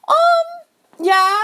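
very high, drawn-out uneasy reply; imitating a reluctant, wary yes; awkward hesitation. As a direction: stall uncomfortably in a squeaky little voice. Acts woman's uneasy answer to stranger.

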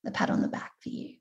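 playful whisper of a little surprise; stage whisper. Acted. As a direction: whisper it like a secret treat. Playful staged reveal of slide.

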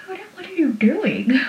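high, hushed, bewildered "what"; baffled. Retold or imitated. Pacey baffled as Joey dresses him, high and puzzled. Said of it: "he just looks at her like".

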